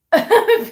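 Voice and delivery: a short, high burst of laughter; real happy laugh; delighted laugh. Real, not acted. Laughs reading viewer's question.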